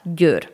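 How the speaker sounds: Győr opening on the Hungarian gy; Hungarian gy sound. Shown as a demonstration. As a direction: demonstrate the gy at the start of Győr. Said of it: "Diesen Laut findet man".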